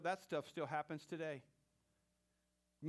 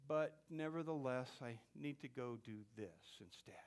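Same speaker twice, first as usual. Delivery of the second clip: doubting aside; 5 semitones lower, 6 dB quieter than usual. Acted. Doubter quoted in sermon.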